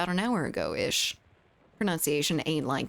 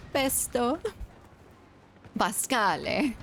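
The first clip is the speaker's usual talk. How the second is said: mock Italian Pesto, higher pitch; fake Italian; 5 semitones higher, slower than usual. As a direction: Pesto said in a hammy Italian lilt. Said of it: "I can't even fake Italian".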